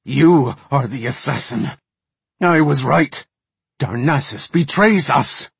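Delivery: loud, high, angry accusation; snarling accusation. A narrator's reading. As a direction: cry out the betrayal in outrage. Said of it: "Varden snarled".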